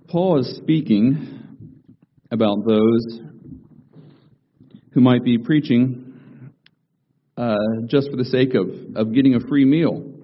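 slow, loud pulpit reading of scripture; preaching. Acted. Pastor reading Philippians to the congregation, slow preaching voice. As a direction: lean into each phrase like a sermon.

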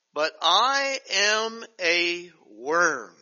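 very slow, weighty reading; slow solemn reading. Acted. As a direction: let the words sink in slowly. Reads verse very slowly.

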